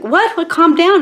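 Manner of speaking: high, loud startled interruption; flustered surprise. Retold or imitated. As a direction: cut in, overwhelmed, trying to stop the flood. Retold reaction to sibling's excited spiel.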